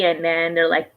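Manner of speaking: ordinary delivery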